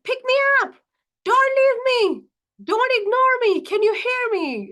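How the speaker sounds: put-on very high, loud demanding child voice; screaming seven-year-old. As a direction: cry out like a small child desperate for attention. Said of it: "It screams at you".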